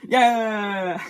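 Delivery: long, loud, high yell; excited cheer. Real, not acted. Vlogger cheering on toy race cars, loud excited yell. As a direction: whoop it out at full volume.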